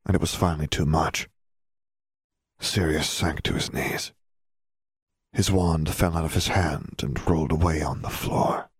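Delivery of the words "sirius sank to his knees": plain reading voice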